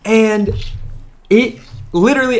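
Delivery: normal talking voice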